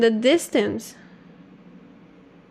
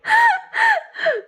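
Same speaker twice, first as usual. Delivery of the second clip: high-pitched laugh. Real, not acted. Laughing at dish name.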